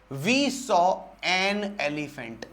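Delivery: We saw an elephant, each word drawn out very slowly; slow read-out. Shown as a demonstration. Teacher reading the answer sentence to the class very slowly. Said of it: "तो क्या कह रहा है".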